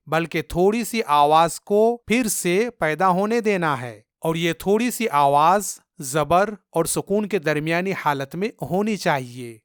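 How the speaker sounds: normal talking voice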